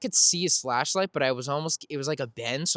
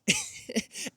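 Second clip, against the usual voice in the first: relieved laugh; 8 semitones higher, 5 dB quieter than usual. Real, not acted.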